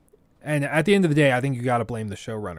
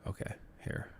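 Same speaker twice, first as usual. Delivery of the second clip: whisper; 15 dB quieter than usual. Real, not acted. Quiet aside while playing.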